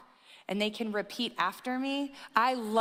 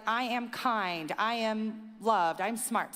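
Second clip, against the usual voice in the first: slow affirmation; slower than usual. Retold or imitated. Daycare affirmation said slowly.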